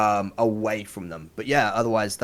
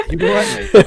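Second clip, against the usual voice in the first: high, louder mock-concerned mate; 11 dB louder than usual. Friends asking if he's okay, mock concern. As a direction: ask it like a worried Aussie friend. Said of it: "it's just like".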